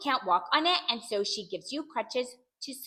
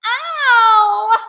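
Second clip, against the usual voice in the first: long, high, loud cry of pain; yelled ow; 11 semitones higher, 16 dB louder than usual. A narrator's reading. Child bangs head on the desk, yelling in pain. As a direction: howl from a bumped head. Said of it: "I yelled".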